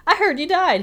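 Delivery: high, loud shocked greeting; mock surprised exclaim. A stranger greeting Snake with hammed-up shock. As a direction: overplay the surprise.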